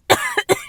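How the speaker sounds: high choking cough. Real, not acted. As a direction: hack out a swallowed crumb. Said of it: "m'étouffer".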